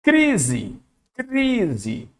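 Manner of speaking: "crise" with a long stressed "cri"; "crise" held on its cluster syllable; stretched demonstration. Shown as a demonstration. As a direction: draw out the first syllable of "crise". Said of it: "encontro consonantal".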